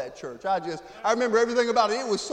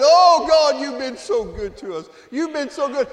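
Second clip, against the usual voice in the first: loud, high cry of thanks to God; half-shouting his gratitude, voice lifted high; overwhelmed praise; 6 semitones higher, 11 dB louder than usual. Retold or imitated. Preacher reliving worship in the van, crying out loud.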